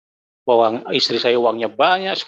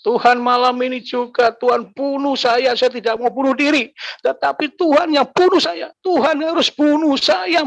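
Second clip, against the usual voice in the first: high, pleading cry to God, pitched up and straining; desperate angry prayer; 11 semitones higher than usual. Retold or imitated. Man crying out to God at night, high desperate plea. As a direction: beg God to take your life, voice climbing higher. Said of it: "Malam itu saya marah".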